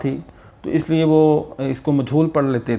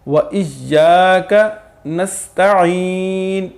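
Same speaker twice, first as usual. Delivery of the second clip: Quran recitation; 4 semitones higher than usual. Acted.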